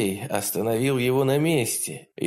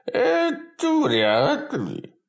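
sneering demon; much slower than usual. Acted. Demon torturer gloats.

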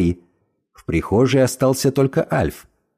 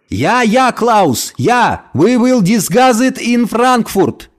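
loud, high, slow phone shouting; yelling into the phone; 7 semitones higher, 4 dB louder, much slower than usual. A narrator's reading. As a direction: bellow it down the phone. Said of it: "Ор в телефон по-немецки".

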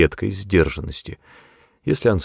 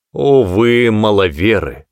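louder pulpit exclamation; proclaiming; 2 semitones higher, 6 dB louder, slower than usual. A narrator's reading. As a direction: cry out to the congregation. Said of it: "он возгласил". (возглашая с кафедры)

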